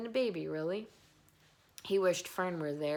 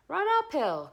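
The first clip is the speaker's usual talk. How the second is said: high, loud sheep call; sheep crying out; 11 semitones higher, 10 dB louder than usual. A narrator's reading. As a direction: bleat it out loud. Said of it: "cried the sheep".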